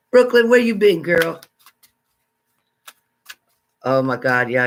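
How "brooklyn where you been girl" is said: high, loud hello to a friend; excited greeting. Real, not acted. Greeting a viewer who finally showed up, excited call. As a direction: call out to her warmly and loud.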